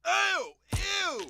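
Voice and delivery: high, loud grossed-out squeal; disgusted shriek. Acted. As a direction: recoil in horror at the hat. Finds dead squirrel in hat.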